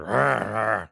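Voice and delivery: put-on old man talking from the mouth's corner; droopy-mouthed mumble. Mimicking an old customer's droopy mouth, side-of-mouth mumble. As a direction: imitate slack, droopy lips with nonsense sounds. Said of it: "out of, like, the corner of his mouth".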